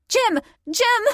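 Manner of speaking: urgent pained calls; gasping cries. A narrator's reading. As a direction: cry out his name in pain. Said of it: "I gasped".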